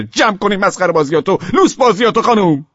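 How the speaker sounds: high, loud exasperated telling-off; angry scolding. Acted. Husband tells absent wife to stop the nonsense, angry scolding.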